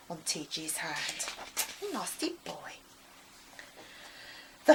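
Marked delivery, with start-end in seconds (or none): 1.9-2.7 hushed disapproval; whispered aside. A narrator's reading. Narrator whispering about Henry. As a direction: whisper it with distaste.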